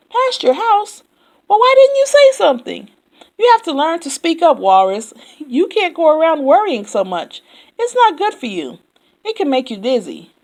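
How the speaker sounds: high, loud and fast scolding; lecturing Yak. A narrator's reading. Yak scolds Walrus, high, loud and fast. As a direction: scold him like a know-it-all.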